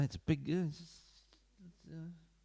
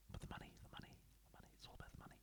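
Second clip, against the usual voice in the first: breathy unvoiced whisper, much softer; whispered aside; 19 dB quieter, much faster than usual. Real, not acted. Whispering to viewers that it's all about money. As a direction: let it slip out as a knowing whisper.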